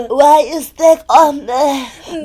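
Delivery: laughing. Real, not acted. Laughing in the Phoebe bit.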